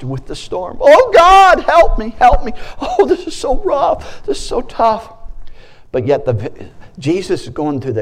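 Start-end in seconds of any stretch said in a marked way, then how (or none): 0.8-5.1 much higher and louder, whining cry; hammed-up panicked prayer, half laughing; mock panic. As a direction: overplay a frightened plea for help. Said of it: "we've been like the disciples".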